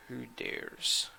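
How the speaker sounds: breathless hiss of an ancient dragon; rasping dragon voice. Acted. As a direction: rasp it out like a huge old beast.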